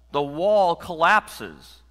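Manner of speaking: slow, high, loud amazement; awestruck. Shown as a demonstration. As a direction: stretch each word as an enormous event. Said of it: "it's all-encompassing, but holy cow".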